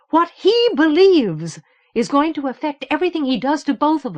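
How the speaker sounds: high, loud, insistent demand; heated demand. Acted. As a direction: press him hard for answers. Teresa keeps demanding answers heatedly.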